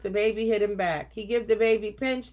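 ordinary delivery, nothing marked

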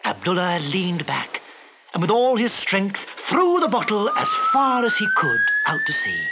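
ordinary delivery, nothing marked

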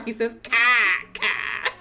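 car said slowly as Boston cah, no r; demonstrating son's Boston car; Boston accent. Shown as a demonstration.

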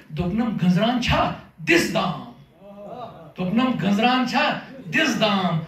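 measured, deliberate verse delivery; poem recitation. Acted. Poet reciting question-and-answer verse to an audience, measured recitation. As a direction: recite line by line, pausing after each cue word.

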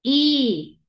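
"E" said as a held eee; long E sound. Shown as a demonstration. Teacher naming the long sound, stretched "E". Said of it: "Sonido largo".

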